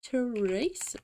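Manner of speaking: put-on drawn-out British-accented name, rising; tentative British accent on Tracer; fake British accent. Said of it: "do a fake British accent".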